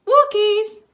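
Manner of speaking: high, loud shout. Acted. Trevor shouting for his dog, loud. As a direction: call the dog home full voice. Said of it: "I shouted".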